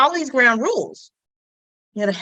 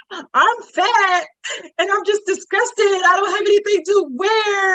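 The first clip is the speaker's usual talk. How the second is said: high, wailing imitation of distress; mock crying; 10 semitones higher, slower than usual. Neighbour crying in her closet over clothes, mock wailing. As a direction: wail it like a hammed-up meltdown. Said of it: "and she's crying".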